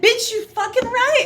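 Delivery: high, loud, cocky exclamation; playful loud boast; mock brash retort. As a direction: fire it back loud and pleased with yourself. Retorting to coworker's compliment.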